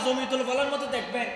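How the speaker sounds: ordinary talk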